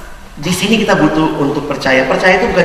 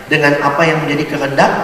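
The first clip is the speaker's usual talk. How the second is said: ordinary talk, nothing marked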